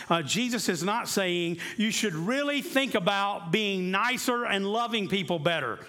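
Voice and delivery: ordinary talk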